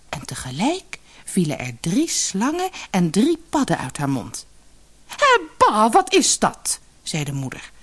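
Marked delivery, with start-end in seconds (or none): 5.1-6.8 disgusted. Acted. Mother seeing snakes and toads, high disgusted cry. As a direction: recoil in revulsion.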